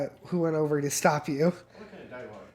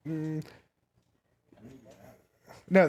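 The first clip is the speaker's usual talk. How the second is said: usual speaking voice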